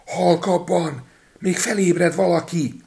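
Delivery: high, slow put-on voice of frightened thieves; hushing him in a panic; urgent hush. A narrator's reading. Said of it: "suttogták".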